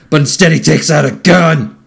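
hammed-up plot twist announcement; mock dramatic reveal. Recapping the buddy's betrayal, mock dramatic reveal. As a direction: hit the twist with mock alarm.

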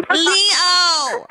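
put-on shrieked Valley girl call. Shown as a demonstration.

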